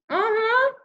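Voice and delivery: high, loud muffled assent; mouth-full reply. A narrator's reading. Boy with string in his teeth, muffled. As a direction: answer without using the teeth or tongue.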